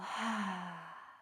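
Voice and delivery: long, soft audible sigh out; relaxing sigh; demonstrated exhale. Shown as a demonstration. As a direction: breathe out to release the shoulders. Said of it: "with an exhale".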